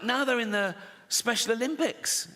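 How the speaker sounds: normal talking voice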